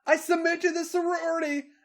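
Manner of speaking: very high, loud yell ending in a scream; acted high-pitched surrender. A narrator's reading. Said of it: "Charlotte yelled".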